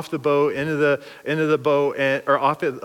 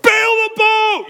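full-throated shout, much higher and slower; yelling; 6 dB louder, slower than usual. Retold or imitated. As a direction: scream the order at the kids. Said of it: "I'm just yelling at them".

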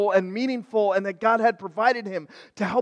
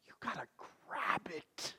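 whispered urging; 15 dB quieter than usual. Real, not acted. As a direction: whisper "grab it" close and soft. Intimate plea to congregation.

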